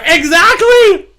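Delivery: high, loud, slow exclamation; excited shout. Real, not acted. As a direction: shout it out with delight. Excited agreement with a viewer post.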